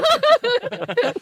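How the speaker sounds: a high, bright laugh that falls away; high burst of laughter. Real, not acted. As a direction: laugh out at the joke. Laughs at joke. (高声大笑后渐弱)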